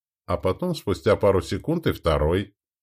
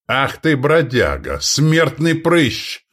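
raised, indignant name-calling; exclaimed insult; 5 semitones higher, 4 dB louder, much slower than usual. A narrator's reading. King advancing on the duke, exclaiming. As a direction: hurl the insults, building louder and higher. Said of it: "воскликнул король".

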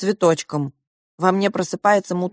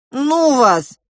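exasperated; 6 semitones higher, 5 dB louder than usual. Acted. Girl exasperated with parents.

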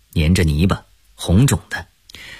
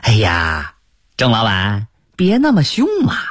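wheedling peddler. Acted. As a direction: plead playfully like a hawker. Peddler pleading after being shouted at.

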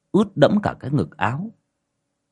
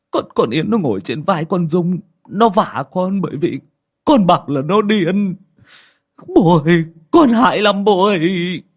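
higher, louder, blubbering to his father; crying like a child; 8 semitones higher, 4 dB louder than usual. Acted. Son crying to his father that the ghost slapped him, blubbering. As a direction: sob it out like a scared child. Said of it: "khóc tu tu như đứa bé".

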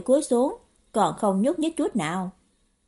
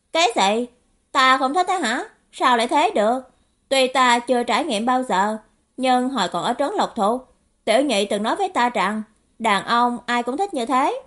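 youngster arguing back in surprise; indignant naive youth; 3 semitones higher, 4 dB louder than usual. Acted. (thiếu niên ngạc nhiên cãi lại)

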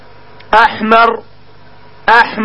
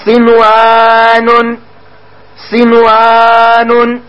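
صنوان stretched very slowly, sound by sound; slow drawn-out model; much slower than usual. Shown as a demonstration. Teacher answering his own question, very slow drawn-out model word. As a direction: draw out صنوان for the class to copy. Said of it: "كيف نقول؟".

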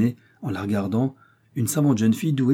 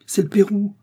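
much higher, gleeful lilt; cheerful; 11 semitones higher, 4 dB louder than usual. A narrator's reading. Daughter telling mother of her prize money, gaily. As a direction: exclaim it with delight. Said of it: "fit gaiement Janine".

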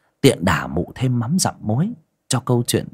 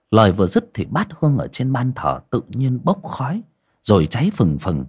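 ordinary delivery, nothing marked

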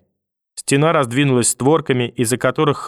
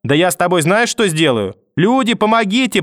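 rising to a cry for help, much higher; mother screams for help, rising sharply higher; mother's scream; 7 semitones higher than usual. A narrator's reading. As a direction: builds from threat to a desperate scream for help. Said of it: "прорвался откуда-то мамин крик".

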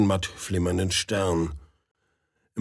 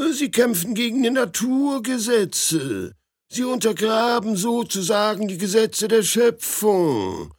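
put-on old man's voice; 8 semitones higher than usual. Old superior reproaches Aljoscha.

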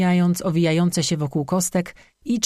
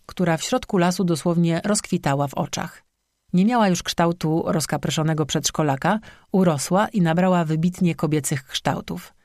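ordinary delivery, nothing marked

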